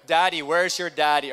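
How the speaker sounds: loud playful call to the room; loud teasing shout to the congregation. Real, not acted. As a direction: call out across the crowd. Calling out to the crowd.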